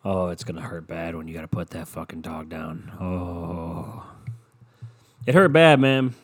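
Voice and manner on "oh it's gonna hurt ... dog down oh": low, soft mock sympathy; mock pity. Warning dog owners of future grief, soft and mocking. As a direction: murmur doom at the dog owners, lightly mocking. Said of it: "just to fucking start being like".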